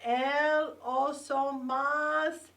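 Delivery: El Oso Más drawn out slowly and loud for repeating; slow teacher model. Shown as a demonstration. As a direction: stretch out El Oso Más syllable by syllable. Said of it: "Say it with me".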